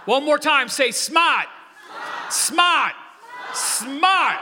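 shouted, high East Coast "smaht"; rally the crowd with a booming East Coast accent. Shown as a demonstration. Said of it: "like you're from the East Coast".

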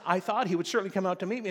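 fast, loud indignant retort; ticked-off Naaman. Retold or imitated. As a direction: rattle it off like an offended general. And he says.

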